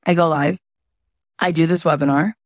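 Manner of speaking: ordinary delivery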